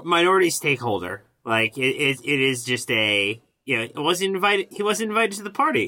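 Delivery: high, loud and fast; laughing excited riff. Real, not acted. Laughing riff on board meeting.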